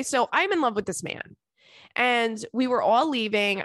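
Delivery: normal talking voice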